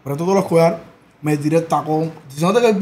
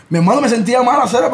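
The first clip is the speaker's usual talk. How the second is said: normal talking voice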